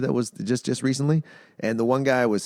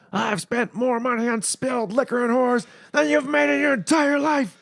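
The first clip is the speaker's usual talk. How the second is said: much higher, shouted wrestling promo; Ric Flair promo; 13 semitones higher than usual. Retold or imitated. Quoting Ric Flair to a friend, hammed-up promo shout. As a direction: strut it like a wrestling heel.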